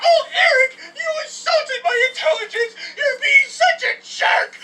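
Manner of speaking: put-on high, loud, wailing girlfriend voice; hammed-up crying shout of an offended girlfriend; mock girlfriend outrage. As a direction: screech it like a hysterical girlfriend. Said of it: "She was saying".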